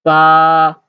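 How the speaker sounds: Ba held long and level; level Mandarin tone on Ba, no fall; first tone. Shown as a demonstration. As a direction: hold Ba loud on one steady note. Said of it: "the first tone".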